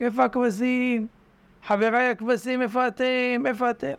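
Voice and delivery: high-pitched call across the woods. Retold or imitated. Man shouting toward the forest for his flock, high call. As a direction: cry out for the sheep. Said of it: "צועק".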